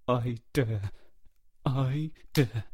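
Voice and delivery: tight, halting self-encouragement; through gritted teeth. A narrator's reading. Boy steeling himself with eyes closed, gritted teeth. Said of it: "kept saying through gritted teeth".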